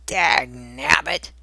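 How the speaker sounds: a grouchy dwarf's curse under his breath; grumpy dwarf grumbling. Acted. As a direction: spit it out like a cranky old dwarf. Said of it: "said Grumpy".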